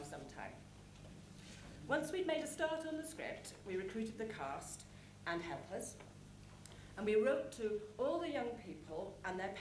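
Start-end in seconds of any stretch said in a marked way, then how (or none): none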